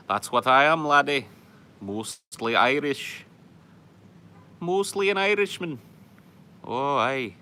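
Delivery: loud, high mock-Irish brogue; put-on Irish accent slipping into Scottish. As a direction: ham up an Irish brogue with rolled R's. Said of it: "that's, like, Scottish. I'm rolling my R's".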